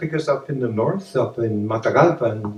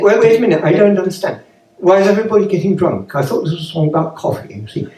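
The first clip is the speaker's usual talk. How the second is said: higher, plummy upper-class English voice; posh sister; 5 semitones higher, faster than usual. Retold or imitated. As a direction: put on your best royal-family accent. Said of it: "my sister talks like the Queen".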